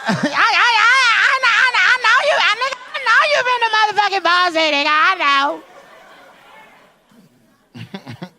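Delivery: very high, loud squealing protest; voice up at her height. Acted. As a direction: stammer then rant fast in a mock falsetto. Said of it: "going up to her height".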